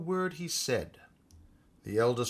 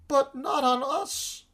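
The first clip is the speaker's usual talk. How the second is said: high, slow alarmed cry; oysters crying out; 12 semitones higher, 4 dB louder, slower than usual. A narrator's reading. Oysters protesting to the Walrus, high frightened cry. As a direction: cry out in mock horror at being eaten. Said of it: "the oysters cried".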